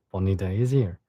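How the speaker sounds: usual speaking voice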